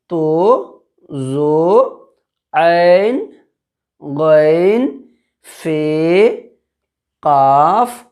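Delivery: loud drawn-out alphabet chant; slow loud classroom recitation; reciting letter names. Shown as a demonstration. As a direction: call out each letter for the class to repeat. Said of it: "उसके बाद है".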